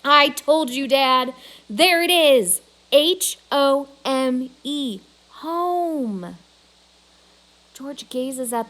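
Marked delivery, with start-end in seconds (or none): 0.1-2.6 loud, high gloating; excited I-told-you-so; triumphant. A narrator's reading. Julia triumphant after yelling yes. 2.9-6.4 slow letter-by-letter spelling; triumphant spelling; spelling out slowly. A narrator's reading. Julia spelling out the word.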